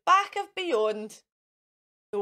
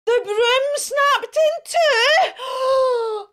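slow, loud, high alarmed narration; dramatic alarm; 11 semitones higher, 9 dB louder, much slower than usual. A narrator's reading. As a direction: stretch it out in shock. Broom breaks mid-flight.

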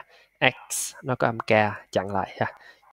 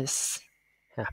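normal talking voice

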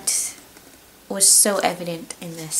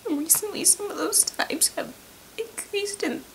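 high, breaking whisper through real tears; tearful whispered gratitude to the author; crying thanks; 8 semitones higher than usual. Real, not acted. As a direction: choke out the thanks while crying. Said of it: "I'm gonna cry again".

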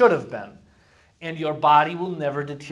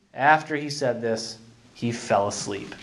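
ordinary talk, nothing marked

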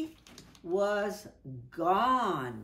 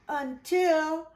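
dramatic build; 6 semitones higher, 8 dB louder than usual. A narrator's reading. Suspense peak of rescue.

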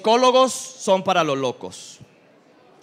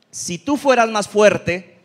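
slow, loud, mock-scolding; a hint of a preachy adviser; slow scolding advice; 2 semitones higher, 5 dB louder, much slower than usual. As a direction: lecture it slowly, like a know-it-all. Quoting someone's advice.

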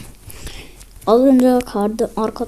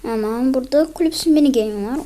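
ordinary talk, nothing marked

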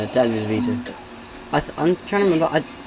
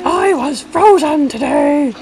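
much higher, slow, louder; high squeaky voice; 14 semitones higher, 6 dB louder, slower than usual. Acted. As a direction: squeak the line out slowly. Repeats line higher.

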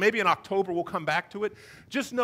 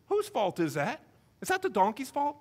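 ordinary talk

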